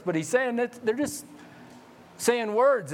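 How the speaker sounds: normal talking voice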